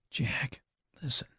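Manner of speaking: soft urgent whisper. A narrator's reading. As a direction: lean in and whisper his name. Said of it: "whispered Betty".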